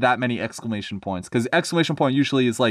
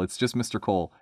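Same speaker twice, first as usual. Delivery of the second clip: plain reading voice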